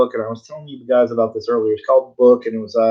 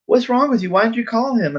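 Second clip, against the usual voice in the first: much higher-pitched imitation of a puzzled friend; retold incredulous asking; 10 semitones higher than usual. Friend asks why he never called the editor, high-pitched incredulity. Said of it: "asking me, he's like".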